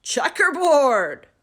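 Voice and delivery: loud, slow shouted word; shouting kid. A narrator's reading. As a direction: call it out gleefully. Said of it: "Someone else shouted".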